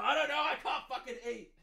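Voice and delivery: a hint of a frantic dad; mock meth dad. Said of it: "the dad's like".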